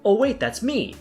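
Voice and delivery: high-pitched comic realization; sudden self-realization. A narrator's reading. Narrator catches own slip, then laughs.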